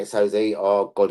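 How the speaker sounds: normal talking voice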